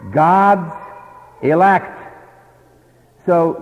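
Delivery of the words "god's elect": "God's" and "elect" loud, each word separate; emphatic answer. Shown as a demonstration. Preacher answering his own question, loud and emphatic. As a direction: land each word hard. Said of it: "No, it doesn't say that either".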